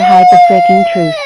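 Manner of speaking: screeching high and loud, demanding the truth; furious shout. Acted. Sister shouting at the evil clone to confess, furious shriek. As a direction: yell it in a fury, pitch flying up.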